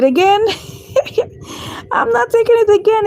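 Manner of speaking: high, laughing no; laughing refusal. Real, not acted. Trader refusing to buy again, laughing and high.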